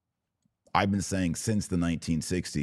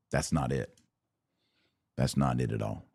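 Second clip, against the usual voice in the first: ordinary talk, nothing marked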